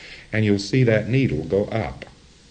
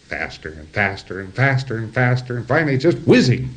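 building quickly, rising at the end; speeding up. Retold or imitated. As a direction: wind it up like a machine gaining speed. Imitates the wave speeding up.

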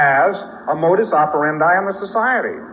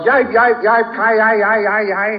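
high, louder yelps of a bitten dog; mock dog yelps; yelping dog; 8 semitones higher, 4 dB louder than usual. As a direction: yip like a hurt puppy. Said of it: "The dog goes".

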